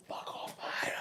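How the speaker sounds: whispered swear under the breath; muttered comeback. Retold or imitated. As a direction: hiss it back low and unvoiced. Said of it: "I'm like".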